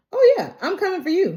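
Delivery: high, fast mock menace; sassy I'm-coming-for-you; playful threat. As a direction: warn them off with a grin. Vows to defend her family.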